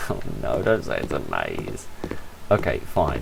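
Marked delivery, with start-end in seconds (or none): 0.1-1.9 mock dread. Real, not acted. Groaning at a maze.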